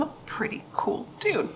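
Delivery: a sly, breathy aside; playful whisper. Real, not acted. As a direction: whisper it like a secret brag. Playful whispered self-praise aside.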